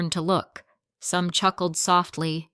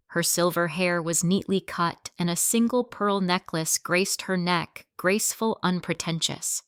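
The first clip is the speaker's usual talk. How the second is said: ordinary delivery, nothing marked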